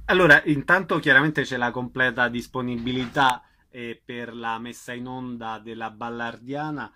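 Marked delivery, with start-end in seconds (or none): none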